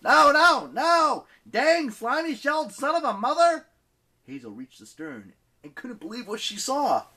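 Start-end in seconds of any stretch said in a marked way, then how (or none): 0.0-3.7 high, loud, despairing cursing shout; yelling. Acted. Leo cursing the turtle for breaking oars, loud yell. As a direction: rage at the monster at full volume. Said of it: "Leo yelled".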